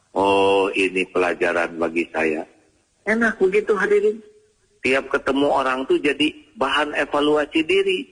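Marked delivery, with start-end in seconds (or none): none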